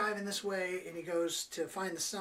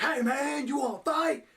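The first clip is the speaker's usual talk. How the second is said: much higher and louder mock tough-guy shout; over the top; 8 semitones higher, 7 dB louder than usual. Gang members confronting Clint and the kid, hammed-up shout. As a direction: ham up a silly gang challenge. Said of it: "it was just over the top and silly".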